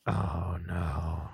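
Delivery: low, drawn-out mock groan; big groan. Recruit groaning at the coming test, drawn-out mock dismay. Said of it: "Big groan".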